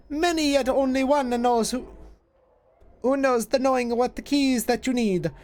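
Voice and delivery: lightly played Jamaican-tinged witch; hint of a Jamaican accent on an old crone; slipping Jamaican accent. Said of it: "the accent is starting to slip into that".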